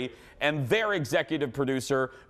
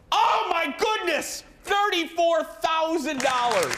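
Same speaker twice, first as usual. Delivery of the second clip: much higher, slow, loud thrilled exclamation; excited shout; 5 dB louder, slower than usual. Real, not acted. Host seeing the jackpot total, shouting in amazement. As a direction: shout it in disbelief.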